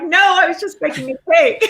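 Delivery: high-pitched whine tipping into laughter; mock crying protest. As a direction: plead like a wrongly accused teenager, half laughing. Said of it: "So I'm crying".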